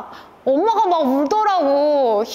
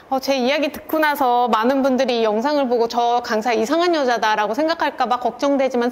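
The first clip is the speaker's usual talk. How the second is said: normal talking voice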